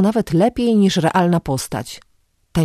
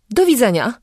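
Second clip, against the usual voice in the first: higher, trembling goodbye; 6 semitones higher than usual. A narrator's reading. Scared woman saying goodbye to the vagrant, trembling. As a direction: quaver out the farewell. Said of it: "powiedziała drżącym głosem".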